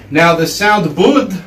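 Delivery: "bud" slow, the tongue between palate and teeth; halfway d. Shown as a demonstration. Teacher explaining the Sanskrit "bud" sound. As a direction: land the d of "bud" just behind the teeth. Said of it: "it's halfway between your palate and your teeth".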